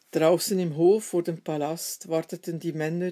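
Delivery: ordinary delivery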